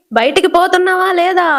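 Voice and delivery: high, loud furious demand; loud angry shout. Acted. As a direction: yell it to drive her out of the house. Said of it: "గట్టిగా అరుస్తది".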